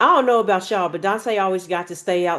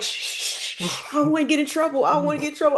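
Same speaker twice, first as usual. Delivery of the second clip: hushed shh, then fast, higher pleading; panicked hush; 8 semitones higher, faster than usual. Retold or imitated. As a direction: rush the words, scared of getting caught. Boy hushes the hurt girl.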